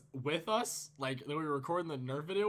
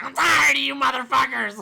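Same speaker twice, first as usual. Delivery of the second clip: loud, high-pitched yell of someone fed up; snapping shout; 11 semitones higher, 16 dB louder than usual. Retold or imitated. Storyteller imitating Nathan snapping at his friends, furious high shout. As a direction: blow up at the whole group, imitating a friend's outburst. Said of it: "And Nathan snaps".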